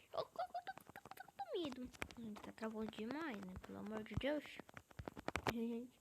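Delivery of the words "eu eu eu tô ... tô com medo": high stuttered "eu, eu, eu tô"; stammering fear. Real, not acted. Gamer telling viewers he is scared of being reported, stuttering high. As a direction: trip over the words in a fright.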